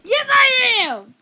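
high, loud outraged protest; indignant shout. Real, not acted. Boy protesting to his brother, shouting high. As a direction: yell it back, offended.

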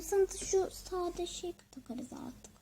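whispering. Acted. Mother whispers.

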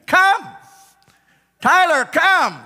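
high, loud holler; calling out. Acted. Preacher acting as shepherd summons a sheep, hollering. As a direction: holler it far across a field.

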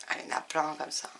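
quick breathy whisper to herself; hushed muttered aside. Real, not acted. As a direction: whisper it under your breath while thinking. Thinking aside while searching books.

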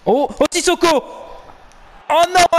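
high, loud anguished shout; excited cry. Real, not acted. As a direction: cry out as the chance slips. Tense attack in match.

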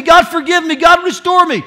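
loud, high, slowed plea; shouted prayer of a repenting sinner; repentant cry. Acted. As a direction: cry out for rescue.

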